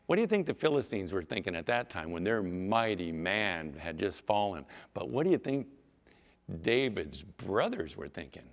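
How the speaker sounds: usual speaking voice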